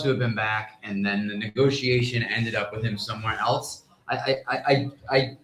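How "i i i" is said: ordinary talk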